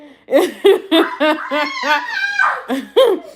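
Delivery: long, high, loud laugh; real, sustained belly laugh. Real, not acted. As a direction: burst into high peals of laughter. Laughs at sister's retold retort.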